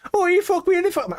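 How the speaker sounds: very high, loud British parody; mock British accent. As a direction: squeal a hammed-up British porn-star line. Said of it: "they're British porn stars".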